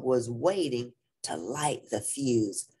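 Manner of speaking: ordinary delivery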